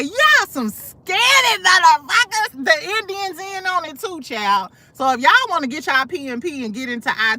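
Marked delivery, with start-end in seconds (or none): 0.0-2.6 higher, slow, drawn-out mock outrage. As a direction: stretch it out like you can't believe them. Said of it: "I said".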